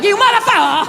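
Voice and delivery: high mock yell at another driver; road rage yell. Comic raging at traffic from the driver's seat, high mock yell. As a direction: scream at the car ahead, high and hammed-up.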